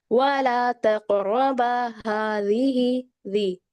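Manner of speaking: slow, drawn-out tajweed recitation; Quran recitation. Acted. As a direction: recite the verse slowly and melodically.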